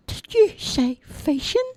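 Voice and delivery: put-on high, childlike simple lad voice; cheerful high-pitched lad; Charlie's voice. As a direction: play the big innocent lug, high and eager. Charlie hears about fishing.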